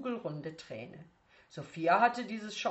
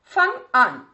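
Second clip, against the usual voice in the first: loud, higher barked order; sharp command; 7 semitones higher, 14 dB louder than usual. Acted. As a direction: snap the order at the pupil. Teacher orders pupil to start.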